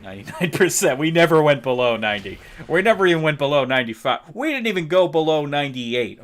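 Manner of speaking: forceful, punchy bragging; emphatic boasting. Real, not acted. Boasting of results with rising force.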